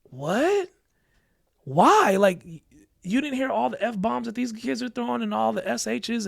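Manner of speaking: indignant disbelief. Retold or imitated. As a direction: protest the unfair call. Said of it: "And he was like".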